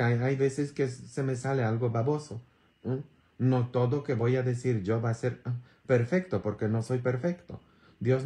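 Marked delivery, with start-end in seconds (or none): none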